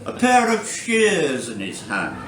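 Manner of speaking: measured poem delivery with deliberate pauses; poetry reading. Acted. Poet reading a gnome poem to a Saturday-night audience, measured recital. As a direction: read verse aloud, lifting each new phrase and letting it fall. Reads own poem aloud.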